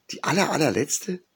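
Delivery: put-on high, incredulous young phoenix. A narrator's reading. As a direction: ask in disbelief, bird voice up high. Young phoenix asks, disbelieving.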